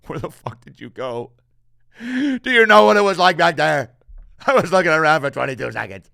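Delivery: frantic dog, rising to a loud high rant and speeding up; a panicked dog rattling off how long you were gone. Pretend. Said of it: "They're like".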